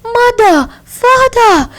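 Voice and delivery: put-on high, frightened child's cry; a lost little boy calling out in panic; high-pitched scared child calling his parents; crying in fear. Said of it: "crying in real fear".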